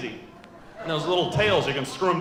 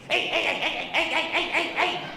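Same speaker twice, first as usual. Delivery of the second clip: much higher, rapid string of greetings; Uncle Arnie; 11 semitones higher than usual. Retold or imitated. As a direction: babble hey over and over like the goofy uncle. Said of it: "My Uncle Arnie".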